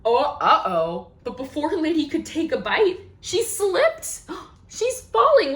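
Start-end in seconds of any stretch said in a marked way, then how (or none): none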